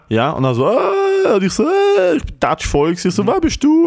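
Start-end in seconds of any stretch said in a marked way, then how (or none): 0.7-1.3 a sudden high-pitched squeak of surprise; high startled squeak; high squeaky stunned äh. Retold or imitated. The dude's stunned reaction. 1.6-2.2 a sudden high-pitched squeak of surprise; high startled squeak; high squeaky stunned äh. Retold or imitated. His own stunned reaction. 3.3-3.9 a high, louder, thrilled wer bist du; high-pitched starstruck question; high excited question. Retold or imitated.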